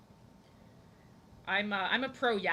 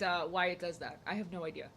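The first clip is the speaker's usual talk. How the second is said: ordinary talk, nothing marked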